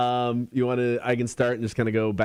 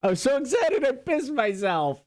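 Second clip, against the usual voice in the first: high laughing blurt; 13 semitones higher than usual. Real, not acted. Co-host jokes about the home run story, laughing high. Joking recap of listener story.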